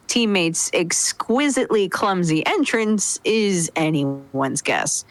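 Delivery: ordinary talk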